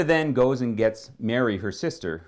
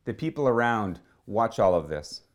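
ordinary talk, nothing marked

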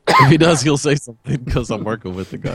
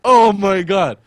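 higher, stunned "Oh my God"; retold amazement, a bit louder; amazed; 8 semitones higher, 5 dB louder, much slower than usual. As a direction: gasp out your disbelief. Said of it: "I was like".

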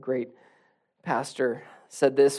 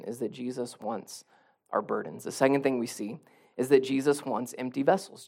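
ordinary talk

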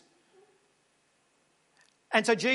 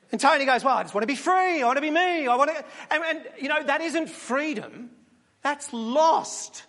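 higher, louder anxious sheep voice; a sheep recounting its friend's folly, raised and urgent; agitated sheep Keith; 4 semitones higher, 4 dB louder than usual. Pretend. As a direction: sheep voice, high and upset. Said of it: "Keith the sheep answers".

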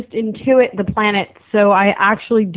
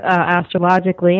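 normal talking voice

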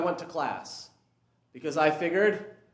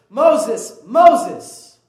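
God calling out; 3 semitones higher, 12 dB louder than usual. Acted. God calls from the burning bush.

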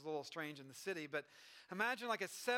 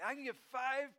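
high-pitched acted delight; amazed kid; 6 semitones higher than usual. Kid hearing the clerk's answer, amazed and high-pitched. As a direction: echo it as a thrilled child, pitched up.

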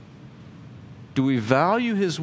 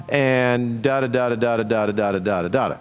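dismissive da da da filler; mock babble. Preacher waving off more church rules, dismissive babble. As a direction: brush off the rest of the list. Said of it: "trailing mock list".

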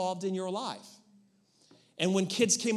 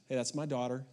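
put-on low, softer, steady talk to the boyfriend; calm, direct father; 6 semitones lower, 4 dB quieter than usual. Father to daughter's boyfriend, quiet and direct. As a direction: lay it out plainly, man to man. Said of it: "being very direct".

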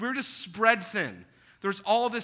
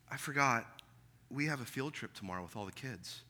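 wife's weary voice; 6 semitones lower, 8 dB quieter than usual. Retold or imitated. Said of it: "she goes".

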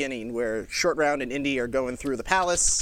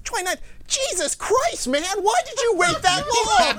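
much higher, shrill-pitched mock scream of a furious clerk; screaming postal clerk; 4 dB louder than usual. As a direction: act out the clerk yelling in disbelief, pitched way up. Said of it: "he's screaming at me".

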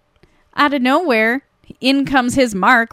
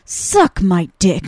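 slow and lower; deadpan line quote; 5 semitones lower, much slower than usual. Retold or imitated. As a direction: deliver the quoted line slowly, dropping the pitch. Quotes the scene's punch line.

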